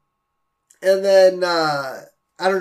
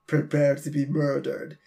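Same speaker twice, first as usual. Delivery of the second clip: muttered threat; 4 semitones lower, 6 dB quieter than usual. Retold or imitated. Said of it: "he mutters to the, under his breath".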